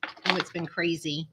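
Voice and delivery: ordinary talk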